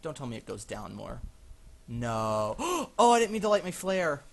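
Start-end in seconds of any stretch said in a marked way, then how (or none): 1.9-2.6 loud, drawn-out no; long dismayed "no". Real, not acted. Dreads going down further. 2.6-4.2 high, loud gasp and cry; loud panicky exclamation; startled outburst. Real, not acted. Lit the flare by accident.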